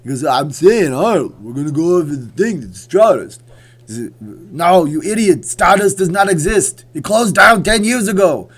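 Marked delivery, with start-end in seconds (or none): 0.2-3.4 loud, slurred action-star impression; badly mimicked movie boxer, loud; Sylvester Stallone. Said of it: "it's really bad on Sylvester Stallone". 4.2-8.5 high, shouted Austrian action-star impression; exasperated action hero yelling; Arnold Schwarzenegger. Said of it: "And Arnold's going".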